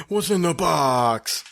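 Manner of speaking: high, slow, theatrical cry of the line; mock movie quote. Husband teasing wife about the box, high slow mock anguish. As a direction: ham up the famous movie line.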